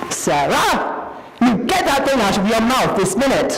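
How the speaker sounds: put-on a scolding mother calls her child sharply; mother snapping at her little girl in the store; shrill mother. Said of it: "a shrill voice rang out".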